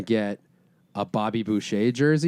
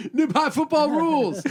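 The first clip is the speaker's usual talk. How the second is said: much higher goofy movie quote; Billy Madison voice; 14 semitones higher than usual. Retold or imitated. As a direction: squeak it out like a silly grown man-child. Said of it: "he goes".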